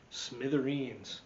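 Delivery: plain reading voice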